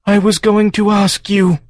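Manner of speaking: high creature voice, weakening; fading farm creature. Acted. The collapsing farm confessing to Joe, high weakening creature. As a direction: confess it as strength goes. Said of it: "said the farm".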